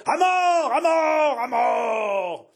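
mob clamour. A narrator's reading. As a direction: roar it like a lynch mob. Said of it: "la sinistre clameur".

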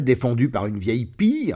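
ordinary delivery, nothing marked

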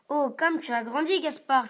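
mother marvels at how he's grown; exclaiming mother. A narrator's reading. Mother exclaiming to Gaspard, delighted and loud. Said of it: "s'exclame maman".

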